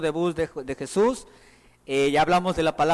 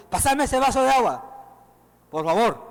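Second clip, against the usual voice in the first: curt demanding; 5 semitones higher, 4 dB louder than usual. Shown as a demonstration. Preacher shows a harsh tone for a glass of water. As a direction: pitch higher and a bit louder, barked command. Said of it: "Hay diferencia en tono de voz".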